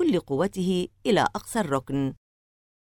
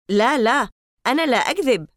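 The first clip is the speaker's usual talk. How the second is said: louder, higher protest; insistent; 7 semitones higher, 6 dB louder than usual. Acted. Larry protests to the boys, loud and high. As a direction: insist hard that it's true.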